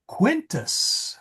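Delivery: Quintus pitched high and punched; spring up high on Quintus; stressed Quintus. Shown as a demonstration. Teacher stressing Quintus to show emphasis. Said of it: "notice the way my voice emphasized quintum".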